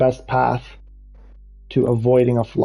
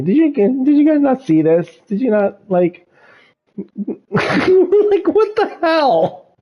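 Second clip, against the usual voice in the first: high, incredulous squeal of disbelief; can't believe the price tag, voice shooting up; stunned, pitch climbing higher and higher; 13 semitones higher, 3 dB louder, slower than usual. Real, not acted. Shocked by car prices.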